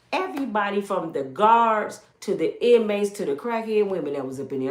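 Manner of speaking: normal talking voice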